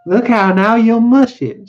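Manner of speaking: high, loud, drawn-out woman's warning; alarmed protest. Acted. Wife objects to her hair being mussed, loud annoyance. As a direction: raise the voice sharply to make him stop.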